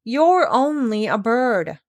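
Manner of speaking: mock sobbing piglet complaint; whimpering pig, lightly voiced; whiny crying. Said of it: "beginning to cry again".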